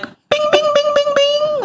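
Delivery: very high sing-song bing bing; game sound imitation. Mom imitating her kids making game sounds, very high chime voice. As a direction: mimic a video game chime, high and bouncy. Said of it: "They're like".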